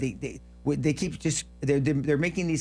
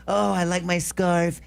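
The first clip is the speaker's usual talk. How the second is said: lightly voiced impression, drawn out and higher; slow, raised mock voice with a hint of an impression; Trump; 5 semitones higher, slower than usual. Host mocking a governor's scarf talk, accidental Trump-like voice. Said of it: "why did I sound like Trump".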